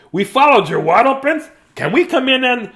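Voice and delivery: high, loud pleading to come in; penguin sisters. A narrator's reading. Sisters asking Dudley to let them in, high and eager. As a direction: pipe up eagerly, loudly.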